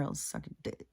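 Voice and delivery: a sly whisper, soft and low. Retold or imitated. Imitating the drunk woman whispering an offer to him. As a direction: breathe it out as a secret whisper.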